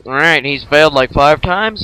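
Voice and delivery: loud, booming mock God; God giving in to the gamer, hammed loud. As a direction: boom it out like God taking pity. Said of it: "I think he's like".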